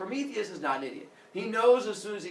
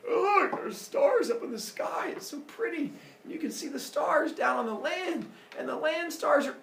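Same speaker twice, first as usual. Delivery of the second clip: dreamy Zeus; 11 semitones higher than usual. Acted. Sleepy Zeus admiring stars.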